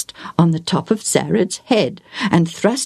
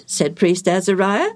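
plain reading voice

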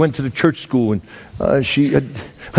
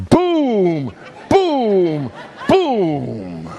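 mock door pounding, higher and laughing; 5 semitones higher, much slower than usual. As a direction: bang it out like fists on a door. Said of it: "I ran at the door and went".

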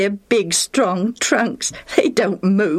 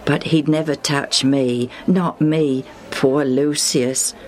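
usual speaking voice

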